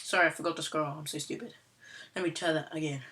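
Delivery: ordinary delivery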